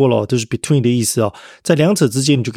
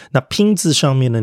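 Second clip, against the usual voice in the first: normal talking voice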